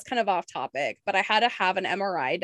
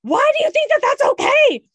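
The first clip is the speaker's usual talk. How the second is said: much higher, loud yell at another driver; 9 dB louder than usual. Retold or imitated. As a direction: scream it through the windshield. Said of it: "yelling the whole time in the car".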